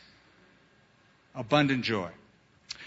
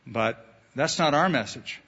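usual speaking voice

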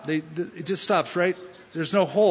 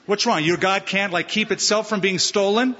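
normal talking voice

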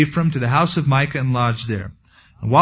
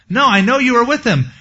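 insistent accusation; 8 semitones higher than usual. Acted. Maid accuses Peter, acted.

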